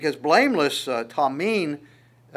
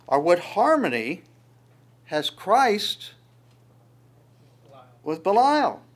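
rising, louder preached reading; scripture reading; 4 dB louder than usual. Acted. Preacher reading Corinthians aloud to the congregation, proclaiming.

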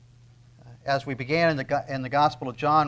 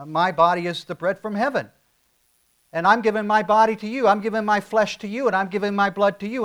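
higher and louder, pressing hard; full-force preaching; 5 semitones higher, 7 dB louder than usual. Acted. Pastor presses the congregation, preaching at full force. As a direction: preach it, lean into every word.

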